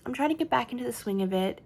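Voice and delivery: usual speaking voice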